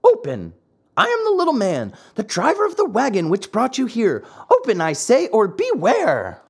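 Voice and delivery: high, lightly voiced little man calling out; calling through the door. The little man calling the donkeys through the door, lightly menacing. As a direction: call through the door with a veiled threat. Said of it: "a voice called to them".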